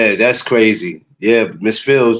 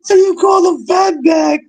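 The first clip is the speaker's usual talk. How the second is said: laughing. Real, not acted. Friend asking through laughter about the nickname. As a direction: shriek it in disbelief.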